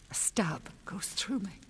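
breathless whisper of the son's name; pained whisper. A narrator's reading. Mother grieving her youngest son, in a whisper. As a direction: whisper the name as grief stabs.

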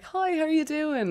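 high-pitched Cork lilt; Cork accent. Shown as a demonstration. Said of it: "we'd spend the summers in Cork as well".

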